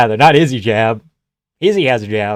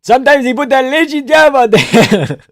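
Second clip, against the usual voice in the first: high squeaky corner coach; high mock coach voice; 11 semitones higher, 6 dB louder than usual. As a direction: mimic a cornerman, pitched way up.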